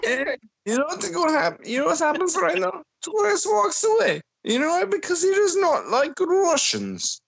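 way up high and fast, hammed-up narrator; high mock voice. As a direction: squeak it out like a cartoon announcer. Mocking commentary voice.

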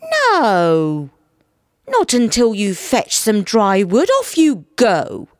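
loud, firm wife's order; bossy wife. A narrator's reading. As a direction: send him off with a loud, drawn-out command. Wife orders husband to fetch wood.

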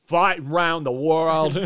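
slow, loud, laughing delivery. Real, not acted. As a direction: laugh through the words. Laughing through the line.

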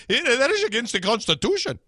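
high, fast, hammed-up Indian accent; mock Indian accent. Host imitates the group's objection, slipping into a mock Indian accent. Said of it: "that's Indian".

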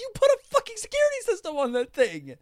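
high, loud outraged yelling; angry rant. Real, not acted. Podcaster yells about an unguarded expensive house, angry rant. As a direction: yell it in sheer exasperation. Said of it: "That made me so angry".

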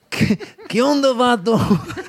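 high, drawn-out mock-Mexican accent; badly faked accent. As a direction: stretch it out in a hammed-up Chicano accent.

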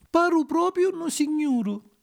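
high, slow self-admiring puppet; delighted puppet child. Acted. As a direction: admire yourself in a high, drawn-out voice. Pinuccio admires himself in the water.

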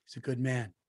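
low, soft murmur of self-praise; Trump impression. Mimicking Trump praising himself in interviews, low and soft. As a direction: purr it low and quiet, smug. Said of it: "he would go".

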